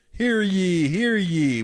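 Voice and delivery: lightly voiced town crier; mock royal decree. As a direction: proclaim it like a herald, half-joking. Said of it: "Why am I talking like this?".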